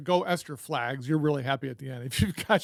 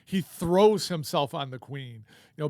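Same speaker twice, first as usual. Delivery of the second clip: ordinary delivery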